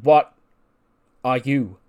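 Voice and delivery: a sudden loud bark on What, then easing off; mock shouted demand. Group leader grilling a member who lost, loud mock bark. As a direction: snap the question out hard, like a boss grilling a failure. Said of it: "He goes".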